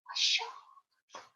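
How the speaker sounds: breathy hushed whisper. Acted. Reading to children, a hushed scary whisper. Spooky hush in the story.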